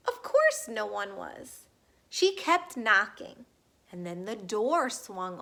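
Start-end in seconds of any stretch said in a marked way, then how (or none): none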